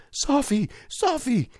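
high-pitched acted scream of a name; narrator's high, strained scream. A narrator's reading. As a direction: cry out desperately, pitch leaping up. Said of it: "and screaming out".

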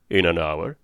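a hint of a growling sea captain. A narrator's reading. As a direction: growl it out like an old sea-wolf. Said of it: "and a growling voice".

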